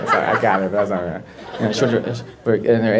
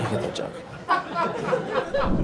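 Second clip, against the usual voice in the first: squeaky high; 10 dB quieter, much slower than usual. Pretend.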